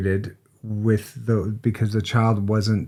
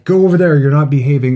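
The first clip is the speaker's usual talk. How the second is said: loud, raised mock telling-off; mock parental scolding; 4 semitones higher, 6 dB louder, faster than usual. Parent sending a child to timeout, loud mock scolding. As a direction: order a kid away, acting it out.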